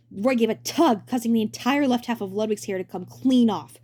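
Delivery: ordinary delivery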